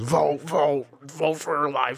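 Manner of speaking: mock campaign nagging. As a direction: imitate someone badgering you to vote. Mimics people nagging him to vote.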